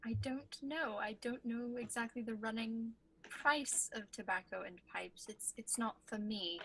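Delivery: soft whisper. Acted. Quietly confiding. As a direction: player character whispers to the shopkeeper, hushed.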